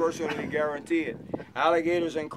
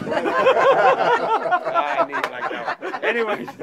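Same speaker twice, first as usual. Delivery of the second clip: laughing hard; 13 semitones higher, 7 dB louder, much slower than usual. Real, not acted. Guide cracks up at own joke.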